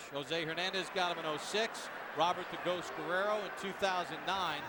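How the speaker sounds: ordinary talk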